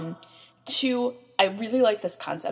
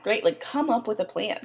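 ordinary delivery

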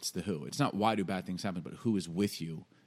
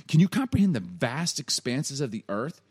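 raised, loud mocking question; God; 4 semitones higher, 5 dB louder than usual. God testing Job's knowledge, loud mocking challenge. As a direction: swell with grand emphasis to belittle him. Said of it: "this is God kinda mocking".

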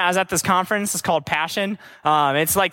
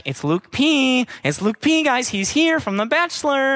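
imitated freaking out; 3 semitones higher than usual. Church group spots a Bachelor star, mock freak-out. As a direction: mimic fans freaking out over a celebrity. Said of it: "freaking out".

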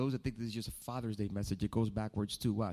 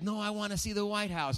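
mock mom voice; 10 semitones higher than usual.

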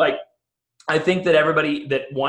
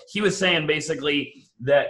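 ordinary delivery

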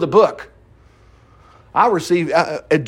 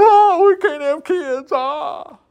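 high, drawn-out fake wail; mock crying; 9 semitones higher, much slower than usual. Preacher imitating barren women crying to an idol, mock wailing. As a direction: wail it in an exaggerated, hammed-up sob. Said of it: "who they're crying, going".

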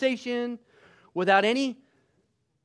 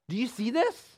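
normal talking voice

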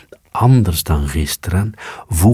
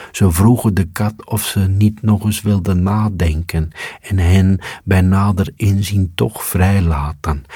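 plain reading voice

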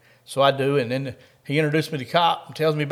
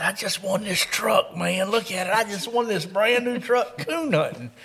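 higher, drawn-out mock gushing; imitated gushing excitement; 6 semitones higher than usual. Buddy showing the cop his new truck, imitated excitement. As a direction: brag like an overjoyed buddy, stretching words.

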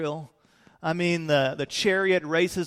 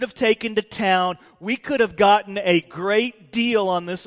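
raised-voice rebuke, each word weighted; laying into someone; 3 semitones higher, 5 dB louder than usual. Acted. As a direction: act out an outraged telling-off. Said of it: "Judas Iscariot starts laying in to Mary".

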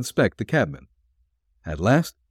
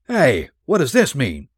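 higher, sharp protest; fierce exclamation; 6 semitones higher, 4 dB louder than usual. A narrator's reading. Brocky protests on being handcuffed, fierce exclamation. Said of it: "Brocky ejaculated with a fierce oath".

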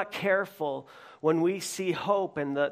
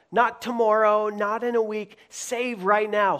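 urgent preaching; 5 semitones higher, 6 dB louder than usual. Acted. Preacher urging crowd's cry.

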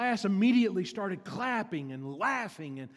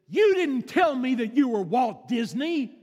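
loud, high-pitched indignant outburst; furious child; 8 semitones higher, 11 dB louder than usual. Retold or imitated. Daughter storms in on her father, acted furious shout. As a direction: mimic a child's outraged yell. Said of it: "she was furious with her father".

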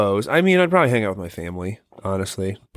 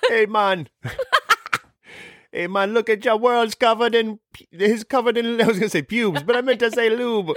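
high-pitched Jamaican alien, giggly; Jamaican accent; 11 semitones higher than usual. Acted. Alien remarking on Earth's covered world, Jamaican accent. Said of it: "give 'em the Jamaican".

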